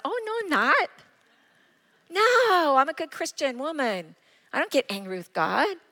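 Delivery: high, defensive protest; mock protesting denial. Retelling her denial to brother.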